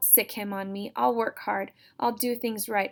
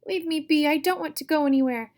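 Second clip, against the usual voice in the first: higher, louder angry yell; angry refusal; 8 semitones higher, 8 dB louder, faster than usual. Acted. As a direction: shout him off in defiance. Sister fights her brother.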